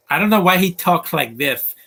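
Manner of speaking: exasperated. Real, not acted. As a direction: throw up your hands at him, loud and high. Complaining about a character's voice.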